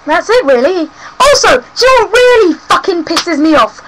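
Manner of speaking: ordinary delivery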